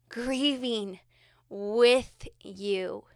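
ordinary talk

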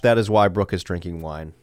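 ordinary delivery

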